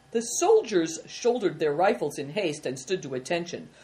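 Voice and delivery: plain reading voice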